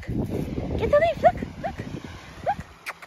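high breathy coaxing whisper; squeaky urging a dog. Real, not acted.

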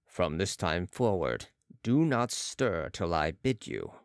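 low, slow, measured order; mesmerist's command. A narrator's reading. Balsamo commands the patient to stillness, low slow order. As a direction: intone it deep and unhurried.

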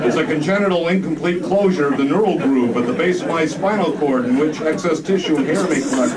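high, loud whining version; whiny Limbaugh repeat. Acted. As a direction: whine the medical definition.

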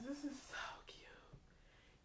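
slow hushed whisper; slow awestruck whisper. Real, not acted. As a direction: breathe it out in awe. Whispered admiration.

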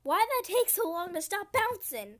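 loud, high frustrated whine; high-pitched exasperation. Real, not acted. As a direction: complain loudly, voice shooting up. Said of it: "Why did my voice go so high".

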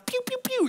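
high, quick laser-shot noises; playful pew-pew shooting sound; imitated gunfire. Imitates shooting down her remarks.

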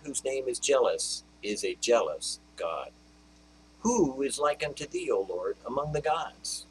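rising, loud preaching of praise; exalting the Lord before the congregation; fervent scripture proclamation. Acted.

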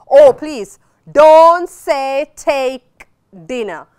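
loud, slow plea "don't say take dinner"; exasperated loud plea; pleading. Real, not acted. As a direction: beg the class, drawn out and loud. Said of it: "teacher pleading against mistake".

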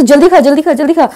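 mock pushy shopkeeper. Mimicking the restaurant man rushing her to eat, hurried bossy mock. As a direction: mimic someone rushing you to finish eating. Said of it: "ऐसा मतलब जिस expression से बोला ना".

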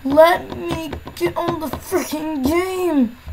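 slow, drawn-out complaint; long-stretched frustrated plea; frustrated pleading. Real, not acted. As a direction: drag every word out in exasperation. Frustrated at failing to join game.

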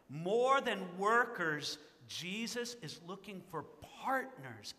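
words drawn right out; slow deliberate build; very slow emphasis. Shown as a demonstration. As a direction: stretch each word. Slowed contrast.

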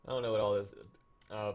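ordinary delivery

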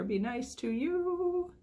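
put-on high, slow squeaky baby creature voice; cute baby gator voice. Unboxing vlogger voicing a baby gator sticker, high and slow. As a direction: give the little gator a tiny sweet voice.

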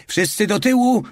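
high, loud shout to get back; warning shout. A narrator's reading. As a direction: bark a warning from the turret. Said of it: "krzyknął ostrzegawczo".

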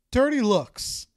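put-on high, loud teenage answer; fifteen-year-old telling her elder what bugs her; teen girl. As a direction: do the blunt teenager.

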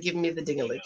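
a hushed, hurried mock plea, lightly voiced; mock Clara begging. Mocking Clara to her viewers, hushed and hurried. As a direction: mimic Clara pestering for it, low and rushed. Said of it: "I bet you all she said".

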